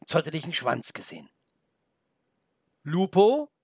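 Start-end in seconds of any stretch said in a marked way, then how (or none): 2.8-3.5 loud, high, rising call; joyful question. A narrator's reading. Felix thinks he spots his wolf friend, joyful. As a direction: call the name in happy hope. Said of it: "fragte er freudig".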